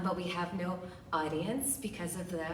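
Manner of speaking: usual speaking voice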